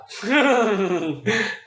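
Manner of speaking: bright rising laugh, high and airy; real delighted laugh. Real, not acted. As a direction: let a surprised laugh spill out. Amused by the insight.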